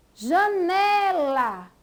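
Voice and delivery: Janela drawn out slowly, leaning on ne; stress on NE. Shown as a demonstration. Teacher giving an example of paroxítonas, slow Janela stressed on ne. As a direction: stretch the middle ne in Janela. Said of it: "ela está mais forte".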